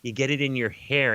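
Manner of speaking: ordinary talk, nothing marked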